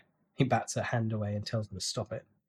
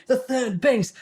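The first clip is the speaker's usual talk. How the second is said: ordinary delivery, nothing marked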